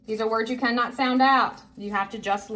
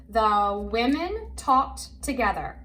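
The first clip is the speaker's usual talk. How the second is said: slow, word by word; careful, spaced-out read-along voice; model reading; slower than usual. Shown as a demonstration. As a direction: read it out slowly for a learner to follow. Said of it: "I'm gonna say it".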